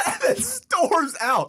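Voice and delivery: laughing while telling, high and slow. Real, not acted. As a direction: laugh through the words. Laughing through the story.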